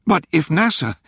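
halting, anxious start; stammering. A narrator's reading. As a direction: stumble over the words. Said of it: "Rachel stammered".

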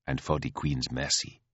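low, soft, composed; quiet queenly rebuke. Acted. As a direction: remind quietly who rules.

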